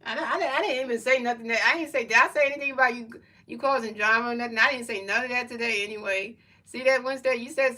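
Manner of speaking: heated protest. Real, not acted. Defending himself to chat member.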